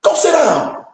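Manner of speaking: high-pitched, sharply falling order; exasperated dismissal. Real, not acted. Telling online advisers off, exasperated dismissal. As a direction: send them off, pitch jumping up then dropping.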